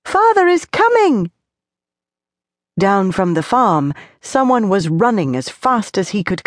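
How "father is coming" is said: put-on high excited child's scream. A narrator's reading. Tony spotting a running man, high excited scream. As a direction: cry out at the sight. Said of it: "Suddenly he screamed".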